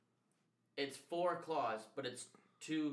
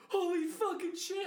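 high, loud, slow panicked exclaim; mock terrified yell; 7 dB louder, slower than usual. On the Vegas claw ride, imitating his own panicked high yell. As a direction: yell it like the ride just dropped.

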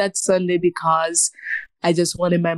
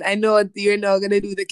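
ordinary talk

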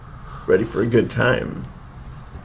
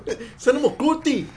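mocking echo; 3 dB quieter than usual.

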